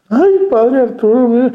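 hammed-up wail of a parishioner begging the priest; mock lament. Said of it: "Tampoco no esté lamentándose".

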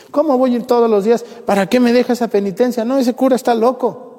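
high, fast mock-indignant parishioner; a hammed-up churchgoer protesting her penance; indignant complaint. Parishioner refusing priest's penance.

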